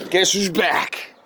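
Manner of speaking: hype call. Acted. Said twice, then whispered.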